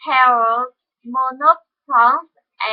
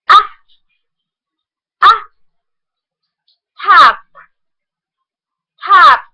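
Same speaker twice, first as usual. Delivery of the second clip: loud drill; 3 semitones higher, 7 dB louder than usual. Shown as a demonstration. Teacher calls out the short o loudly. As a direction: top with a short o, called out loud. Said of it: "the monophthong".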